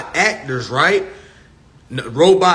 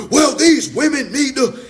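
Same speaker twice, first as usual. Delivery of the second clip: mock complaining man; 8 semitones higher than usual. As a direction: gripe about women, much higher. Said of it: "We already been talking about".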